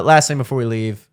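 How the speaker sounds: usual speaking voice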